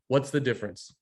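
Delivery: ordinary delivery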